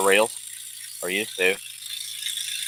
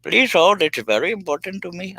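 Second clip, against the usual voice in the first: chirpy fake call center greeting; mock hold recording; 10 semitones higher than usual. Caller mocking the hold message, high sing-song. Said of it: "hold music".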